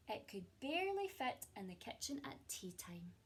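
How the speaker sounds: expressive storybook reading with hushed moments; gentle picture-book narration; reading to children. A narrator's reading. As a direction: read it like a cosy bedtime story to a class. Teacher reads picture book aloud.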